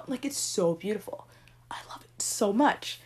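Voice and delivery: dreamy whispered gush. Real, not acted. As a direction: whisper it like a secret love. Gushing softly about beloved trope.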